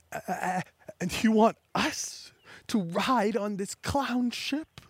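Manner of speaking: high, slow, whispery incredulous question; appalled disbelief. Acted. Appalled at riding the clown ship, high whispery disbelief. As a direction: draw it out slowly, aghast, half whispered.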